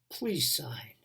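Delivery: hushed whisper begging for the signature; whispered plea. Retold or imitated. Doctor begging father to sign, whispered plea. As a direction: whisper it gently, pleading.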